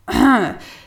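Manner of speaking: quick embarrassed throat clear; loud hasty throat clearing. A narrator's reading. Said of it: "ich räuspere mich hastig".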